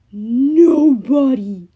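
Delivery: slow, loud, furious giant yell. Acted. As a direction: roar the name in rage. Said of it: "Polyphemus yelled back".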